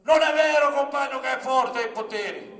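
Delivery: loud, high rally oratory; Capanna's shouted speech. Retold or imitated. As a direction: shout it to the whole assembly, hammering each phrase. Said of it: "poi gridò a tutti". (comizio gridato a gran voce)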